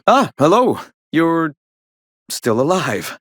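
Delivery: a startled, pleased greeting; surprised hello. Acted. Greeting the captain he thought was gone, surprised and loud.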